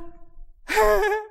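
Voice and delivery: put-on very high, held baby giggle; imitated toddler giggle. Toddler popping up after every fall, imitated high giggle. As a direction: squeal a drawn-out toddler laugh.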